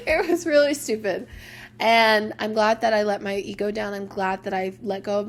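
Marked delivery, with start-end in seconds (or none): 0.0-1.3 laughing. Real, not acted. Laughing at own pride.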